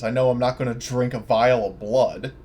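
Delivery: ordinary delivery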